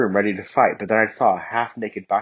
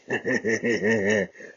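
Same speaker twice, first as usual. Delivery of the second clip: seductive chuckle. Acted. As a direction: chuckle at her like you're in charge. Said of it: "he chuckled seductively".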